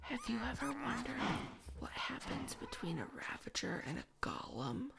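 soft, half-whispered question to viewers; hushed teaser. Acted.

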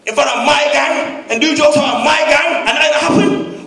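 ordinary talk, nothing marked

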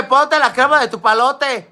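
mock chant of a viewer's comment; playful quote. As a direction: ham up the silly line.

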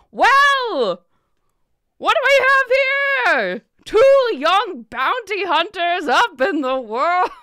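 very high, loud, slow theatrical greeting; booming jovial merchant. Acted. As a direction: throw your arms wide and boom it. Jovial big merchant greets loudly.

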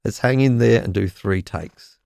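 ordinary talk

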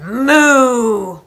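very loud, drawn-out moo; cow moo. A narrator's reading. Cow trying harder to wake farmer, mooing loudly. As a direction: bellow like an insistent cow. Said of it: "when the cow said".

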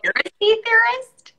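high, incredulous teasing question; teasing disbelief. Real, not acted. Teasing her cohost about his genetics fears, high incredulous. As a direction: jump way up in pitch, mock amazed.

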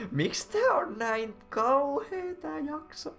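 high, slow wail of complaint; exasperated whine. Real, not acted. Reader complains about the episode. (korkea, venytetty valitus)

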